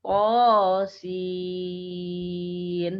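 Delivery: To sung out loud, sin held long; correct To sin. Shown as a demonstration. Teacher repeating the correct reading to students, chanted. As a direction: chant To then stretch sin. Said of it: "Tapi dibaca panjang".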